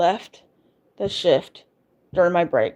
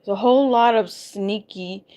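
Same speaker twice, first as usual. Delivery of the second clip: ordinary talk, nothing marked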